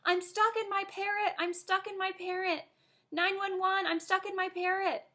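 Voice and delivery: put-on high, urgent child panic; panicked shout. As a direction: yell for help, stuck and scared. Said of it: "I started to panic and shouted".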